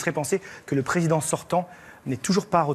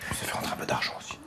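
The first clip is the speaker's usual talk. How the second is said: whispering; 7 dB quieter, faster than usual. Real, not acted. Aparté glissé au voisin.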